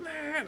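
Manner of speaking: a brief, soft, high imitation of a baby's wail; imitating baby crying. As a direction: squeal like the screaming toddler. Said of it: "she's just blasting away".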